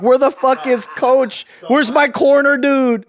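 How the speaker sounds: loud, high panicked fighter shouting for his coach; fighter yelling. Retold or imitated. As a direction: yell for the missing coach. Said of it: "The fighter's like".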